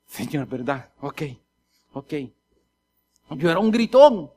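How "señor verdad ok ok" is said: low, subdued acceptance; small, soft, obedient reply; meek compliance. Acted. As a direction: give in quietly to a reminder. Acted meek answer to the Spirit.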